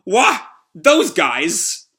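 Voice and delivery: high, loud startled gasp into a question; shocked exclamation. Acted. Villain seeing the team smash through, shocked exclamation. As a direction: blurt it in disbelief.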